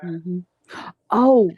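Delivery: ordinary delivery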